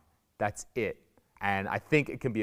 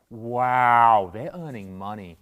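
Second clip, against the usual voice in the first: mock awe; 2 semitones lower, 4 dB louder, much slower than usual. Classmates amazed that students earn money, mock awe. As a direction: marvel at it, hammed-up.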